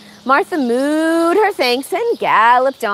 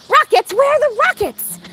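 much higher, eager questions; excited cow; 10 semitones higher than usual. Acted. Cow Martha eagerly asks Rob, high excited questions. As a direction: look around eagerly.

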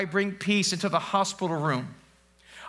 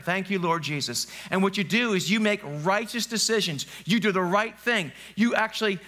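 usual speaking voice

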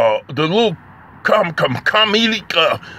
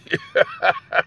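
very high, squeaky cartoon giggle; 4 dB quieter than usual. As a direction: squeak out a silly little laugh. Signing off with a silly laugh.

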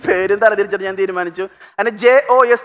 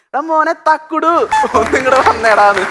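slow, much higher drawn-out mother's call; 5 semitones higher than usual. Retold or imitated. Mother calling her son to the guests, long and high. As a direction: stretch it out calling the boy in. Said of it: "അമ്മ അവിടെ നീട്ടി".

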